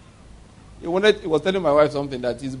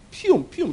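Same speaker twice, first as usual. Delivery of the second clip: gunshot noises; 8 semitones higher, 3 dB quieter than usual. Retold or imitated. Imitating the gunshots.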